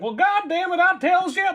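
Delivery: hammed-up cranky old-timer, high pitched; cranky old man. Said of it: "Cranky old".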